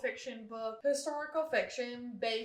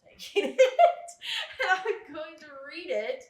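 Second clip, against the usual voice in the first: laughing; 5 semitones higher, 7 dB louder than usual. Real, not acted. Cheekily taking friend's unread book.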